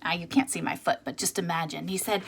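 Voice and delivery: usual speaking voice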